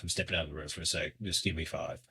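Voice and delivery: soft, quick, low-key words to his wife; quiet retold aside. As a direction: murmur it fast as you step away. Telling wife he's stepping out.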